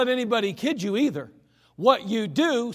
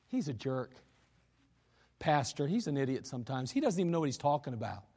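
ordinary talk

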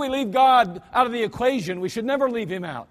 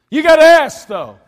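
sudden loud, higher burst; emphatic shout; 7 semitones higher, 16 dB louder than usual. Real, not acted. Preacher urging the congregation, suddenly loud and high. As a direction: hammer home the command. Preacher drives home the point.

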